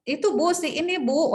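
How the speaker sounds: high, fumbling mock-nervous answer; a touch of panic, stammering to the examiner; flustered student. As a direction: imitate a student caught out. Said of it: "dia gelagapan nih".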